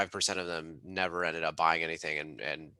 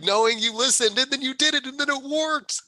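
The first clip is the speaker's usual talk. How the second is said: much higher and louder, overjoyed; 12 semitones higher, 6 dB louder than usual. Real, not acted. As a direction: gush with real elation. Gushing about Victor's win.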